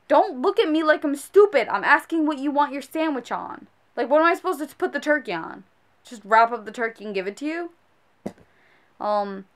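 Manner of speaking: ordinary talk, nothing marked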